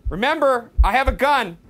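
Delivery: loud, high warning; threatening shout. Real, not acted. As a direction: warn off whoever is lurking. Threatening the ghosts.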